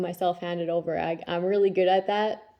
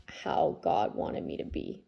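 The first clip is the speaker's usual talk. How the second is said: whisper; 6 dB quieter, slower than usual. Real, not acted. Aside about personal growth.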